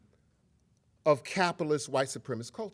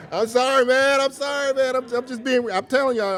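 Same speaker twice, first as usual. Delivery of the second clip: high, loud apologetic laugh while teary; laughing through tears; 10 semitones higher, 9 dB louder than usual. Real, not acted. Speaker apologizing to the audience for crying, laughing loudly. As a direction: laugh it off, still choked up. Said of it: "I'm sitting up here crying and shit".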